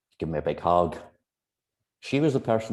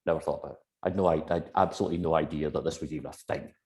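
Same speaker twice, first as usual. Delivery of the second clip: ordinary talk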